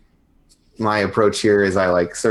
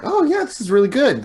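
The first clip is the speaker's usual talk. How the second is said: high-pitched put-on praise; mock delight over food; feigned enthusiasm. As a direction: gush falsely, pitched way up. Acting fake praise of the food.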